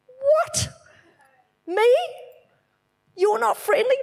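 high, astonished squeak of disbelief; stunned that the thought points at her; shocked disbelief. Retold or imitated. Realising the unfriendly one is her, startled high disbelief.

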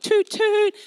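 car horn toot. Retold or imitated. Retelling a driver honking, high loud horn toots.

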